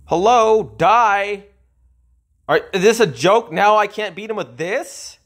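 high, loud exasperated yelling; angry disbelief. Real, not acted. Gamer watching his unit lose yells at the game, high and loud. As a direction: yell at the screen in outraged disbelief.

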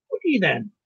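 put-on loud challenge in an Irish accent; Irish accent cry. A narrator's reading. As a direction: demand it loudly as an Irishman. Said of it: "he cried in his own unmistakable accents".

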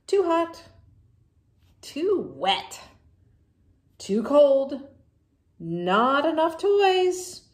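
loud, whiny string of complaints; fussy pigeon. Acted. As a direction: gasp and fuss at every little thing. Pigeon complaining about bathwater.

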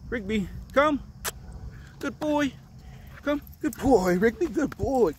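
high call and praise to a dog; talking to a dog. Real, not acted.

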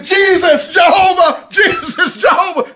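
high, loud desperate shouting; screaming names. Retold or imitated. Running from demons, screaming for Jesus, loud and high. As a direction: cry the names out at the top of your voice. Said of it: "And I said".

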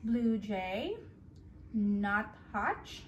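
soft, gentle picture-book read-aloud; reading to a child. Acted. Parent reading a bedtime book to a toddler, gentle storytelling. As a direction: read the story softly to the little one.

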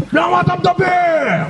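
high, drawn-out mock shout; exploding sergeant. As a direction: stretch the words into a sergeant's furious holler. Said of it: "הוא על סף פיצוץ".

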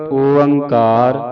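ਓੰਕਾਰ said very slowly, each sound held, rising at the end; drawn-out Onkar. Shown as a demonstration. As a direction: stretch ਓੰਕਾਰ out long and clear. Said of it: "ਇਸ ਤਰ੍ਹਾਂ ਉਚਾਰਨ ਕਰਨਾ". (ਓੰਕਾਰ ਹੌਲੀ ਤੇ ਲਮਕਾ ਕੇ)

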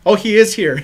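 surprised, laughing exclamation; delighted laugh on discovery. Real, not acted. Spotting a friend outside, laughing.

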